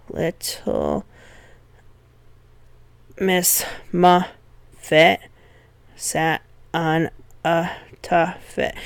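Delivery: slow, sing-song reading with "Little" and "Muffet" hit hard; nursery rhyme beat. Shown as a demonstration. Teacher recites the rhyme to show its dactyl beat, slow and rhythmic. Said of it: "So here I've got Little Miss Muffet".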